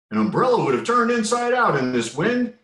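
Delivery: high, loud rooster voice; crowing rooster. A narrator's reading. Little rooster remarking on the wind, crowing. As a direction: crow it out like a cocky little rooster. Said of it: "crowed the little rooster".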